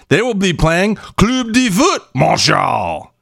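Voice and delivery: slow, with Club de Foot Montreal said the French way, "foot" like "fut"; French club name. Shown as a demonstration. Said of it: "This is not even Spanish".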